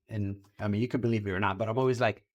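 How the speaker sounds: normal talking voice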